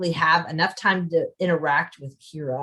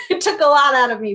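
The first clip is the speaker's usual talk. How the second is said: rueful laugh, higher and louder; 6 semitones higher, 6 dB louder, faster than usual. Real, not acted. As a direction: laugh through the confession. Laughs about hard writing year.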